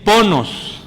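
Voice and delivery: "ponos" drawn out slowly, accent on the first o; teacher slowly showing "ponos", first syllable stressed. Shown as a demonstration. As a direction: lean on the O of "ponos". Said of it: "el acento está ahí en la O".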